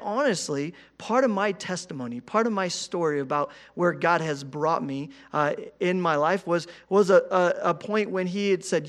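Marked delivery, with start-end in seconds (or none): none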